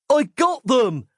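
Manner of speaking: high-pitched cry of success; triumphant cry. A narrator's reading. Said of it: "he cried".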